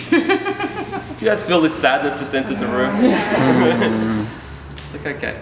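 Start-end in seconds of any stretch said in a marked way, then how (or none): 0.1-4.3 laughing. Real, not acted. Joking about the sad mood.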